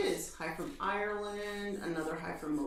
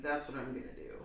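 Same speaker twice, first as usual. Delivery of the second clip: normal talking voice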